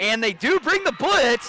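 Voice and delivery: loud, high play-by-play call; excited call. Real, not acted. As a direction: call the blitz as it happens. Live call of a blitz.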